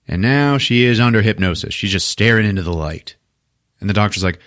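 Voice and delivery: normal talking voice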